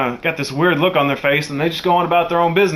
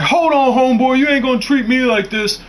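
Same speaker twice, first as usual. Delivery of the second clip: angry retort; 9 semitones higher than usual. Retold or imitated. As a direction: square up to him, offended. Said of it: "dude gets so angry".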